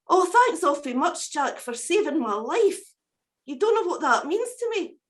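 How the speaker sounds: high, chirpy little bird voice, grateful; a small rescued bird thanking its saviour; wee cuckoo voice. Acted. Cuckoo thanks Jack for saving its life, high grateful bird voice.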